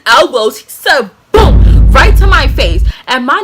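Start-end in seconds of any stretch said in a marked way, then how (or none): 1.3-1.9 loud, high punched boom; explosive sound effect; imitated impact boom. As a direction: hit it like the blow itself. Imitates the elbow hit.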